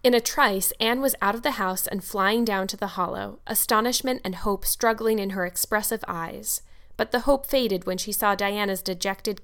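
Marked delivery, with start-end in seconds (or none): none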